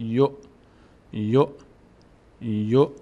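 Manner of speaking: short "یو" cut off by a glottal stop; hamza instead of واو. Shown as a demonstration. («یو» کوتاه با همزهٔ ساکن)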